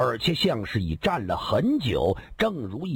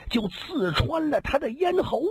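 gleeful bandit; 8 semitones higher than usual. Acted. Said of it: "大笑着说道".

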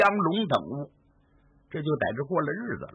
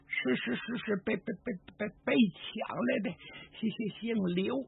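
high, soft, stuttering in fear; teeth-chattering stutter, barely getting words out; terrified stammer; 7 semitones higher, 5 dB quieter than usual. Acted. Old woman answers Yan Qing in a high, terrified stammer.

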